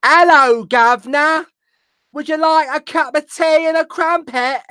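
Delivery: very high, hammed-up Cockney squawk; mock Cockney accent. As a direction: put on a silly, high-pitched Cockney voice. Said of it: "I imagine it sounds a little something like this".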